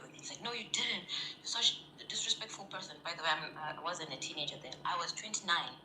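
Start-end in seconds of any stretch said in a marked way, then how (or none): 0.4-3.0 higher-pitched retold scolding; mock indignation. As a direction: imitate an indignant father accusing. Retelling father's indignant accusation.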